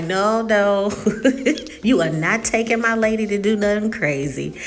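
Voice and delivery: mock objection with a laugh; laughing protest. Groom-to-be vetoing a wild bachelorette party, laughing protest. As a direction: laugh as you playfully forbid it. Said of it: "share a moment to just laugh and just giggle".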